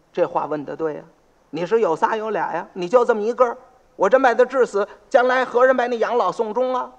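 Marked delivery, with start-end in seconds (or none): none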